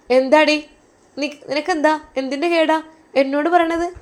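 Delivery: sister-in-law mocks the weeping girl, loud and high; scornful retort. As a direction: snap back at her with contempt. Sister-in-law snaps back.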